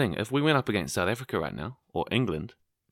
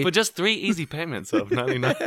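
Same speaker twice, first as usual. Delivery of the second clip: home shopping host; 9 semitones higher, 5 dB louder than usual. Pretend. Infomercial pitch parody.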